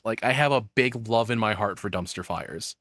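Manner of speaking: ordinary talk, nothing marked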